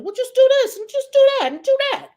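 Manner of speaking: high, fast, loud pestering; mock meddling parent. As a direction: imitate a parent rattling off orders. Acts herself meddling in kids' lanes.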